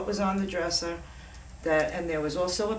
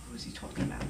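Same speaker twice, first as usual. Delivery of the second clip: hushed inner thought; 8 dB quieter, faster than usual. Retold or imitated. Inner thought, breathy hush.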